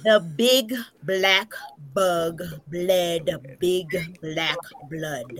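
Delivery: slow, careful tongue twister; slow start. Shown as a demonstration. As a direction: begin it slowly. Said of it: "start off slow".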